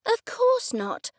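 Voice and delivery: high, drawn-out indignant cry; angry retort. A narrator's reading. Suspect answers the detective's question, angry. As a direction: fling it back at him, offended. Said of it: "I said angrily".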